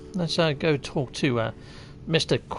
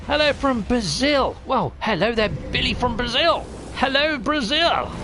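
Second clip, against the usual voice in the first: excited greeting; 7 semitones higher than usual. Real, not acted. Hailing a viewer from Brazil.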